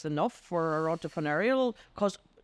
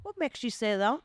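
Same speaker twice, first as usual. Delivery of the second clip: curious listener demo; 8 semitones higher than usual. Shown as a demonstration. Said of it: "And then I would go".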